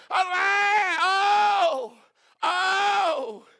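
high, loud, crying out alas in despair; desperate lament over the lost axe head; anguished wail. Shown as a demonstration. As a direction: wail it like a man who lost what he borrowed. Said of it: "It's easier to demonstrate".

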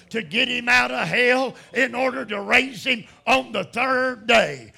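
loud, high, shouted preaching; preaching at full force. Acted. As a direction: thunder it out to the congregation.

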